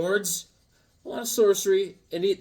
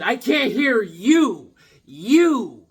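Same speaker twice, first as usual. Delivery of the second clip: slow, higher, louder, spelled out; slow loud call; 6 semitones higher, 5 dB louder, much slower than usual. Retold or imitated. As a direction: drag it out loudly, much higher. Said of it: "I said".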